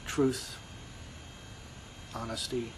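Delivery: ordinary talk, nothing marked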